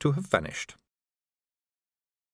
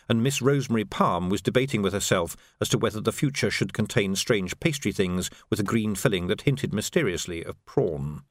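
ordinary delivery

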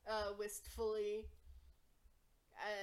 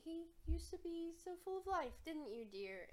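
higher, doting carnival lady; Palasha voice; 7 semitones higher than usual. Acted. Palasha tells the party of her love's lost voice, higher and doting. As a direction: sigh over her poor dear in a higher voice. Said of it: "Yeah, he".

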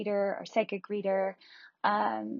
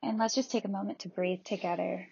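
normal talking voice

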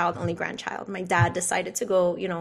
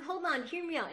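much softer, higher hold on, hear me out; soft therapist plea; 8 semitones higher, 10 dB quieter than usual. Retold or imitated. Therapist quietly urging the angry speaker to listen. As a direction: hush him gently to listen. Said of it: "He's like".

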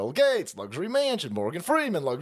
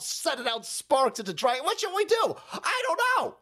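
mock panic; 6 semitones higher than usual. Mocking panicked islanders.

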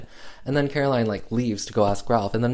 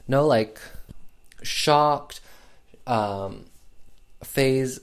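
usual speaking voice

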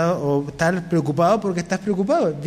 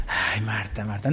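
"Ay, Marta, Marta" sighed much lower and softer; soft sighing version; 9 semitones lower, 7 dB quieter than usual. Shown as a demonstration. Pastor imagining Jesus sighing at Marta, very soft and low. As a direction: let "Ay, Marta" fall away gently. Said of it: "no sabemos".

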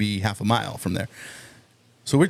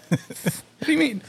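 put-on confused drunk; 14 semitones higher, slower than usual. Confused drunk reply to cop.

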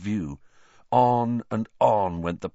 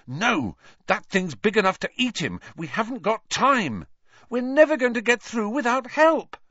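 desperate; 13 semitones higher, 3 dB louder than usual. A narrator's reading. Harry's desperate reply.

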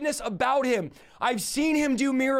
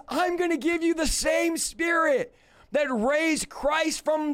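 slow, high, shouted exhortation; rally the congregation at full voice; fired-up revival preacher; preaching shout; 4 semitones higher than usual. Real, not acted. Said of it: "Why is this guy shouting?".

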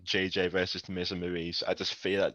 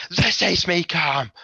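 higher, louder voice for Molly's deadpan threat; mock menacing reply; 7 semitones higher, 6 dB louder, slower than usual. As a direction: play her as barely contained. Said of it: "she just turns to me and goes".

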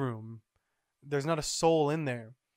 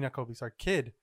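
usual speaking voice

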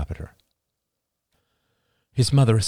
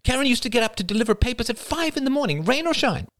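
higher, hurried eager mother; mother quickly defending her sister; rapid talk. A narrator's reading. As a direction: rattle it off eagerly. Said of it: "said Marie rapidly".

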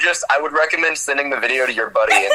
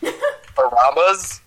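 surprised; 8 semitones higher, much slower than usual. Real, not acted. Surprised at surname.

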